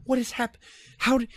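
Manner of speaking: high-pitched mock rant at partiers; incredulous rant. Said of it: "I'm like".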